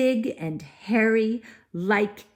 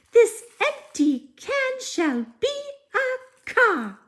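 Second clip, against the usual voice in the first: very high chanted magic words; fairy spell; 4 dB louder than usual. Acted. Fairy casting a spell to send Cinders to the disco, high chant. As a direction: sing out the spell like a tiny fairy.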